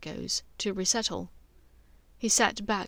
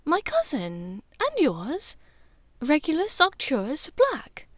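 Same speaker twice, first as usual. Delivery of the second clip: higher motherly voice, kindly teasing her son; Narcissa, gentle mother; 5 semitones higher than usual. Acted. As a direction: play the caring mother checking on her son.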